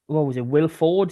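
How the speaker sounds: normal talking voice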